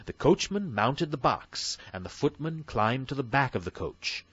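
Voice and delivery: ordinary delivery, nothing marked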